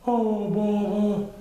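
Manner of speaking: slow, high sighing voice; sighing Pooh monster. Acted. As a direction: sigh in mild annoyance. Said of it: "sighed Frankenpoo".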